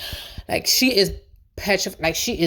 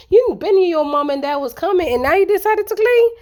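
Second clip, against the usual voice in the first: much higher, louder disbelief; incredulous scolding; 9 semitones higher, 4 dB louder than usual. Real, not acted. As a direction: call her out, can't believe it. Scolding Natalie's late cleaning.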